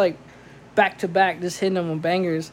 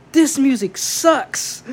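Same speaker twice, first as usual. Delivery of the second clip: slow, loud and higher, mocking her contempt; drunk insult; 6 semitones higher, 5 dB louder, much slower than usual. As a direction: drag it out like a drunk heckler.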